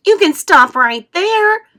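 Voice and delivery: loud, high, slow sharp rebuke; indignant worm snapping loudly. A narrator's reading. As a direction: cut him off hard. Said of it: "the earthworm said sharply".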